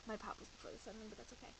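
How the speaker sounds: soft, fast offhand aside; quiet aside. Real, not acted. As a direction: mutter the correction. Reader's aside on mistake.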